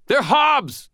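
very high-pitched scream; screamed warning. A narrator's reading. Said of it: "I screamed at Michael".

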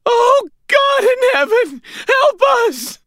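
very high, loud, quavering plea; agonised scream. A narrator's reading. Landlady fallen from the ladder, screaming in agony. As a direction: scream it slowly, quavering with pain. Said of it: "She screamed, agony in her quavering voice".

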